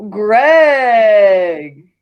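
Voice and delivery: shouting the poll winner; big reveal. Acted. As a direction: call out the winner like a game show.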